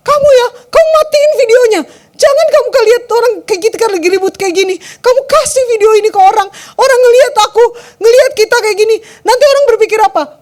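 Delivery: acted furious wife, high and loud; increasingly angry. Wife ordering her husband to stop the video call, angry yelling. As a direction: shout at him in tearful outrage. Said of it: "si cewek kan makin marah".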